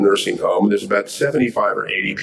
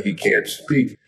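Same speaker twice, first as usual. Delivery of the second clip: ordinary delivery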